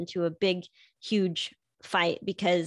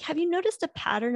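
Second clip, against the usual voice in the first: much higher, quick sly question; husband teasing; 9 semitones higher, faster than usual. Retold or imitated. Husband hints at her monthly pattern, high sly teasing. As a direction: ask it slyly. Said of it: "he's like".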